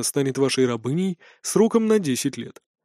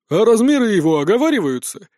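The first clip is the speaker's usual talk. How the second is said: businesslike; 6 semitones higher, 5 dB louder than usual. A narrator's reading. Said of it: "деловито поинтересовалась Варра".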